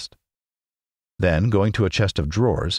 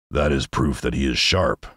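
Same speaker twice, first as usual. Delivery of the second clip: a lightly lower Lion voice; the Lion quips in his lower growl; 5 semitones lower than usual. A narrator's reading. The Lion jokes to his friends, lightly low voiced.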